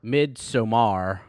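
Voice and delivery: MIDSOMMAR drawn out slowly, ending on a full "mar"; hit the R. Shown as a demonstration. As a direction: stretch "Midsommar" slowly and land on the final R. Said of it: "gotta hit the R".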